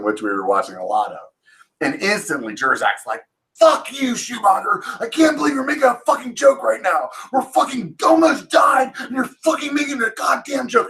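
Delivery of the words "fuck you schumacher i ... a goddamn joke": cussing him out. Retold or imitated. Shaken soldier yells at his joking tower buddy, furious shout. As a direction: scream at your buddy for joking after nearly dying. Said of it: "he's cussing me out".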